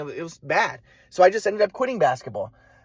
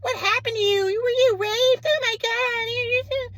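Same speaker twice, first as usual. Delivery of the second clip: very high, drawn-out wailing mother imitation; hysterical mom; much slower than usual. Mom demanding what happened to son, slow high wail. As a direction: stretch each question into a piercing mock wail.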